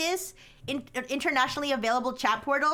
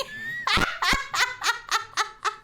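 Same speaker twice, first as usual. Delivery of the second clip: drawn-out laughing fit; long high laugh; 10 semitones higher than usual. Real, not acted. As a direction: keep laughing at it. AI attempts Swiss accent.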